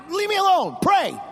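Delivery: mildly hammed-up impatient father; annoyed brush-off. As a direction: shoo the kid away. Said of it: "I just, I".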